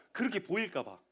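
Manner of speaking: ordinary talk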